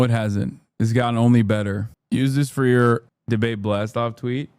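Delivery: usual speaking voice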